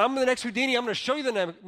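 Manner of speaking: high, loud showman's brag; bold boast. Retold or imitated. Magician promises a stunt to his audience, bold boast. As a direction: announce it big, like a stage magician.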